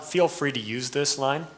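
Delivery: ordinary delivery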